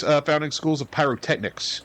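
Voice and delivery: usual speaking voice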